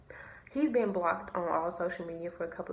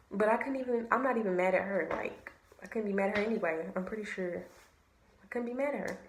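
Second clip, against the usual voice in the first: normal talking voice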